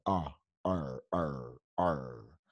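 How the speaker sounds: very low, soft "ar" held as an r-coloured "er"; er sound demo. Shown as a demonstration. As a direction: drill "ar" as a deep, isolated English er. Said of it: "¿Cómo logramos ese sonido?".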